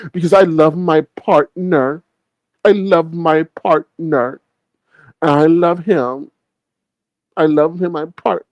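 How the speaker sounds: slow, drawn-out baby talk, imitating him; mock him like a little kid, stretching each word; little kid whine. Host mimicking him on his partner, like a little baby. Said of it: "like a little baby, like a little kid".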